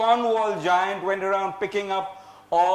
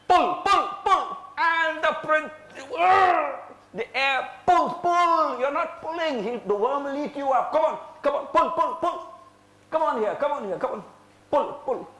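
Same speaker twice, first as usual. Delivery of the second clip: rallying shout; 9 semitones higher than usual. Real, not acted. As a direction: drive the tug of war. Leads kids pulling the worm.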